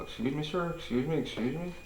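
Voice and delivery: ordinary talk, nothing marked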